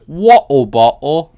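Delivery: drawn-out, badly faked English vowels; bad English accent. Imitating friends' game chat, loud bad English accent. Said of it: "talking about bad English accents".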